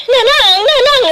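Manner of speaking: high, loud calls to mother; calling out. Acted. Daughter calling loudly to her collapsing mother. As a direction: call her back in alarm.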